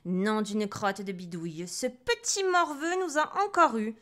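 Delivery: fuming storybook witch; indignant little witch. A narrator's reading. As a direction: curse like a cross little witch, rising at the end. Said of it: "chuchotaient dans la poubelle".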